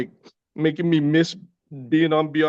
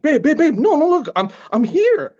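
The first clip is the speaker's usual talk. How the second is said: much higher, louder coaxing babe; high soothing to child; 5 dB louder than usual. Retold or imitated. Father soothing his crying daughter at the movie, high coaxing voice. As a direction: soothe a crying little girl, high and bright.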